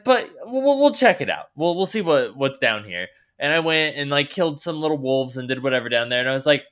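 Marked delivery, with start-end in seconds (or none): 0.0-3.1 pitched well up, loud and eager; high excited self-talk, hamming it up; reckless eagerness. Retold or imitated. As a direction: talk yourself into a risky detour, brightly.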